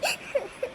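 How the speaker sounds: a short, quiet sob trailing off; soft sob. Acted. Shreya sobbing softly in front of her sisters-in-law. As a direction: let a small sob fall away. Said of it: "કેમ રડો છો?".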